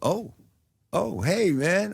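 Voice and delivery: mildly startled retold hello; kinda shocked. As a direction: imitate someone caught off guard. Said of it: "looking kinda shocked".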